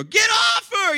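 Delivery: high, loud scream at a dog. Retold or imitated. Pastor retells calling off his dog, screaming. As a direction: scream a frantic command at your dog. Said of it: "I'm screaming at this dog".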